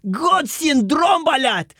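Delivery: slow, hammed-up Russian accent; put-on Russian accent, drawn out. Shown as a demonstration. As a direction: announce it with a thick mock Russian accent. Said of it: "או עם מבטא".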